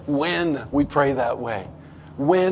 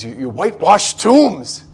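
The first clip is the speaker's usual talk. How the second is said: mock rebuke; 4 semitones higher, 10 dB louder than usual. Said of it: "he said to the Pharisees".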